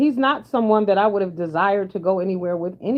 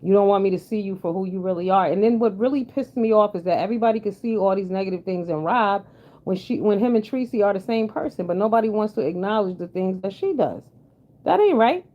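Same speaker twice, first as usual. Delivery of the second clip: normal talking voice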